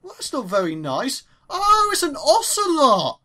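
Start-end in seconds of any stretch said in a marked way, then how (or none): 1.5-3.2 high, loud, drawn-out delight; delighted discovery. Real, not acted. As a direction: coo loudly over an adorable animal find. Spots an ocelot in game.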